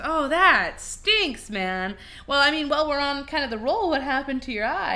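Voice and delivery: lightly voiced bartender. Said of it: "the bartender says".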